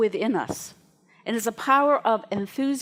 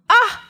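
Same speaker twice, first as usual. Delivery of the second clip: high loud mock shriek of surprise; imitated shriek; 12 semitones higher, 10 dB louder than usual. Susanna startled by the backhoe, high loud mock shriek. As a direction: let out a startled squeal. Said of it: "I could hear Susanna and go".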